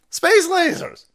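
high, loud yell. Real, not acted. Ranting harder about space lasers, loud high yell. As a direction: really belt it. Repeated louder.

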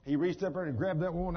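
ordinary delivery

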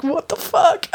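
high cracking shriek of disbelief; shocked friend. Retold or imitated. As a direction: scream it in shock.

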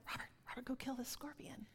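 urgent hushed whisper. Retold or imitated. Wife whispering to husband mid-prayer, hushed. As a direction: whisper so the prayer isn't broken.

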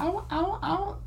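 very high, soft ow ow ow; tiny squeaky cries; teasing falsetto ows; mock squeal. Joking squeals over the picture.

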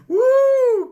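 high, loud joyful whoop; spontaneous cry of praise. Real, not acted. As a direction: let out a high happy whoop. Preacher fired up.

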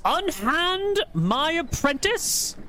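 high, ringing call over the crowd; clear call. Acted. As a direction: call out clearly to stop them. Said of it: "calls out in a clear voice".

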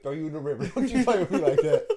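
high, loud joking threat breaking into laughter; playfully threaten, cracking up; laughing mock threat. Telling his friend how he joked at his girlfriend, laughing.

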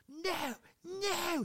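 put-on very high crying toddler no; toddler tantrum. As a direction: wail like a toddler refusing food.

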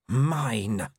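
lightly voiced wolf claiming her own, growling. A narrator's reading. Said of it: "growled the wolf".

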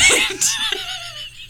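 high laugh trailing down; high falling laugh. Real, not acted. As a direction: laugh in disbelief. Laughing at the absurd exchange.

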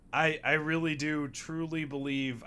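usual speaking voice

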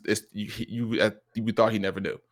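ordinary delivery

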